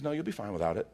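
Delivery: soft reassurance. Retold or imitated. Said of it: "And Jesus says".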